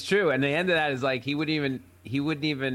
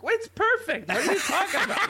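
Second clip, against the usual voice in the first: high-pitched, incredulous retort; hammed-up baffled protest; mock indignant dad. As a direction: defend it like a stubborn dad. Stubborn dad defends botched repair.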